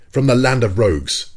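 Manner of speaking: forceful, indignant answer; angry retort. A narrator's reading. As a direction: fire back hotly. Ned angrily answering the challenge.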